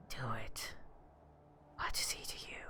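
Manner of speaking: lightly voiced old whisper in the head; raspy old voice whisper. A narrator's reading. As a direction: tempt them in a hushed rasp. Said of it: "a high, old, raspy voice that says".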